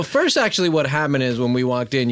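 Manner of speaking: ordinary talk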